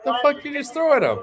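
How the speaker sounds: high, loud disbelieving cry; shocked outburst. Real, not acted. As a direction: blurt it out in sudden shock. Commentator reacts to thrown object.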